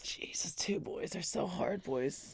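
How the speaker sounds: real hushed whisper. Real, not acted. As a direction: breathe it out, no voice. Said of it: "whispered aside".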